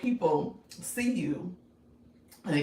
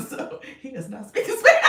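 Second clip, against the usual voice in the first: real laughter bursting through louder, higher words; breaking into laughter; 6 semitones higher, 9 dB louder than usual. Real, not acted. Host cracking up over her own Spanish attempt, laughing hard. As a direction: crack up as the line ends.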